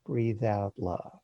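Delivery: normal talking voice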